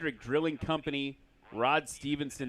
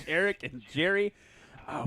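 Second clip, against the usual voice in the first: ordinary talk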